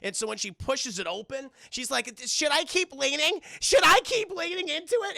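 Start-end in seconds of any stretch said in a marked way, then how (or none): 2.3-5.2 mock ditzy. Annie pushing door.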